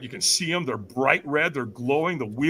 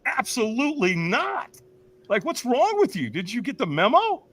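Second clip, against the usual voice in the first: indignant refusal; 7 semitones higher than usual. Retold or imitated. Speaker answers stranger asking them to walk, indignant. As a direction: refuse as if the question is absurd.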